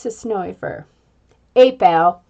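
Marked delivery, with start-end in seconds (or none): 1.5-2.2 louder, lower casual "Hey, pal"; a cool cat greeting the boy. Acted. Talking cat greeting the startled boy, casual and loud. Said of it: "he says".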